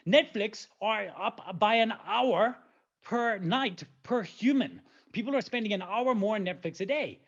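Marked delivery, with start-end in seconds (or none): 0.1-4.8 very slow, every word weighted; slow emphatic. Real, not acted. Presenter stressing the Netflix figure to the audience, slow and emphatic. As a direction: land the number word by word. Hammering home the Netflix statistic.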